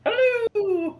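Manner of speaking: very high, loud squeaky hello; mock calling out. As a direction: call playfully into an ear in falsetto. Player calls into severed ear, joking.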